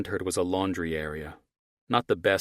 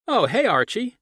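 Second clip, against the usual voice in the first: higher, louder friendly neighbor; 9 dB louder than usual. Acted. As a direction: call out a casual greeting. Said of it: "the male voice said".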